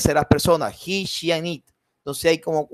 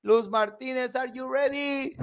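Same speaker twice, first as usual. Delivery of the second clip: calling out; 8 semitones higher, 4 dB quieter than usual. Real, not acted. Calling again on silent student.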